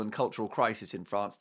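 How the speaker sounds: ordinary delivery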